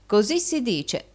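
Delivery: mildly brusque retort; curt. A narrator's reading. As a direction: answer curtly, pitched a little up. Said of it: "rispose Rodolfo seccamente".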